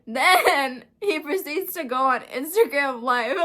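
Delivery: can't hold back a stunned laugh; laughing in disbelief. Real, not acted. Telling viewers about his livestream, laughing in disbelief. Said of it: "I'm not laughing because it's funny".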